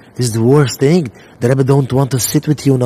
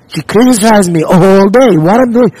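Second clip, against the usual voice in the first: high, loud exasperated complaint; exasperated husband; 6 semitones higher, 5 dB louder than usual. Retold or imitated. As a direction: vent like a nagged husband.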